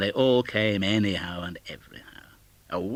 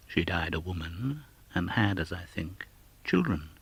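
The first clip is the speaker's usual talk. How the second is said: ordinary delivery, nothing marked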